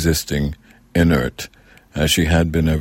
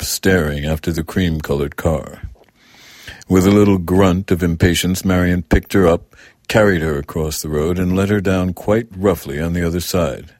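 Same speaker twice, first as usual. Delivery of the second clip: plain reading voice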